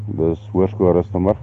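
ordinary delivery

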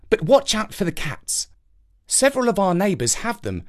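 put-on chatty little boy. Jake talks to crow.

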